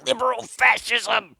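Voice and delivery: high, slow mock outrage; outraged gamer shout. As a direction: fling the slogan like an indignant nerd. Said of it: "Another shouted".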